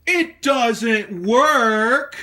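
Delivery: loud, high, drawn-out yell; stretched-out furious holler; frustrated shout. Acted. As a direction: bellow it slowly in mock outrage. Failed power test, shouted outburst.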